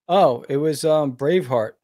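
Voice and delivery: ordinary talk, nothing marked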